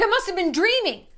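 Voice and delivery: high put-on voice of the ex; mock ex excuse. Ex brushing off the lie, high mock voice. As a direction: play the ex, high and innocent.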